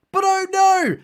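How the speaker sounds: high, hammed-up dismay; mock alarm. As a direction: exclaim in exaggerated fake horror. Narrator feigns alarm at disaster.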